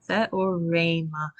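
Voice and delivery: "Theorema" slow, each syllable clear; "Theorema" stretched out for a learner; slow model word. Shown as a demonstration. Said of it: "Yes, good".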